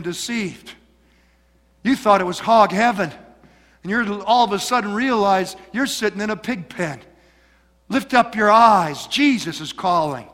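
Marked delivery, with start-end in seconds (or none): none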